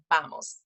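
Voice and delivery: "vamos" with closed lips, like "bamos"; lips pursed together. Shown as a demonstration. Teacher showing lip position to parents, B-like V. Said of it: "lips are gonna be pursed together".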